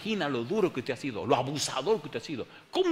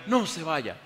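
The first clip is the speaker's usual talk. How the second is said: mock yell; 5 semitones higher, 4 dB louder, slower than usual. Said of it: "Y me gritaba".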